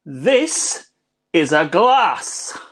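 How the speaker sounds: drawn-out, word-by-word mimicry; slow, literal answer. Friend answering the French girl about the beer, imitated very slowly. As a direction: imitate him explaining it very slowly.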